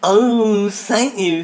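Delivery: a loud, high burst of thanks; overjoyed that someone came looking; delighted relief. Acted. Delighted at being searched for.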